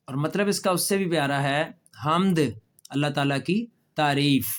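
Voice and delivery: ordinary talk, nothing marked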